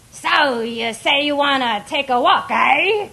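loud, high hammed-up pirate; pirate impression. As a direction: growl it like a stage pirate. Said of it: "her pirate impression".

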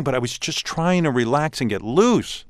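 usual speaking voice

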